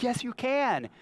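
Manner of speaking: high, playful yes you can; high mock insistence. Dad insisting to his daughter, high and playful. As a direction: bounce it back at her. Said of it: "I said".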